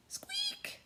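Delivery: tiny squeak, very high; mouse squeak. Acted.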